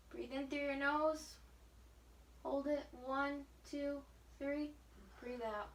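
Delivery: quiet, even coaching cue; gentle counted breathing instruction; soft breathing cue. Shown as a demonstration. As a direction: keep the count soft and calm. Counting breaths during deep breathing.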